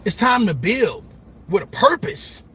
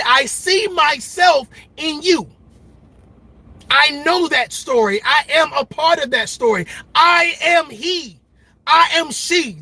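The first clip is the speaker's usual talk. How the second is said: passionate testimony; 6 semitones higher than usual. Retold or imitated. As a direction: proclaim it like a testimony. People voicing identification.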